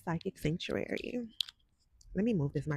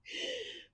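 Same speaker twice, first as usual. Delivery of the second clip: soft high laugh; 12 dB quieter than usual. Real, not acted. Laughs at own question.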